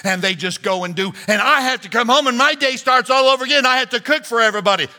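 high, loud, rushed wife's rant; complaining wife. Acted. Pastor voicing a wife venting to her husband, high and loud. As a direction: pour out the wife's grievances, high and fast.

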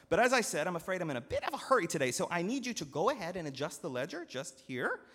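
hurried steward. Acted. Steward rushing the farmer to sign, fast and loud. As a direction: hurry him along politely.

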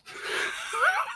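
high, disbelieving laugh; high stunned laugh; burst of laughter. Real, not acted. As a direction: laugh out in shock. Mold ruined the records.

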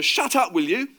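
loud, high, exasperated order; urgent, impatient yell; shout. A narrator's reading. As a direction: shout down a rambling friend. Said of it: "shouted Peter".

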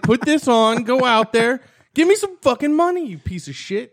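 mock pimp orders. Playing a pimp sending him to the corner, mock bullying.

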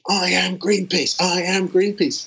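mock shout to pump up the troops, drawn out; retold rallying shout. Fundraising leader pumping up street teams, retold shout. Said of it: "you know, shouting".

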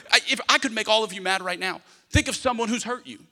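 normal talking voice

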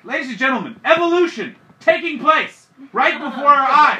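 a touch of ringmaster grandeur; mock announcer.

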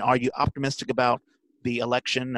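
ordinary talk

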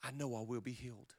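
soft, inward murmur; softly, believing it; quiet confession. Shown as a demonstration. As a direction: say it under your breath, to yourself. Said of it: "I choose to say".

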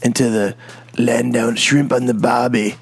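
hammed-up, shaky attempt at Australian; bad Australian accent. Shown as a demonstration. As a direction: try an Aussie accent and miss. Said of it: "I can't really do Australian".

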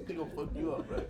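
ordinary delivery

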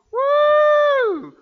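very high, loud woo; excited whoop. Real, not acted. Preacher celebrating David's win over Goliath, excited whoop. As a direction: let out a shouted whoop.

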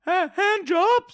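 put-on high, slow, eager old man voice; drawn-out creepy welcome, high and slow; high old-timey shopkeeper. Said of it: "my new character".

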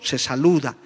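normal talking voice

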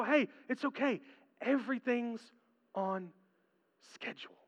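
soft, fading calm voice; quietly soothe, letting it fade away; gentle reassurance. Pretend. God looking at the anxious believer, softly reassuring.

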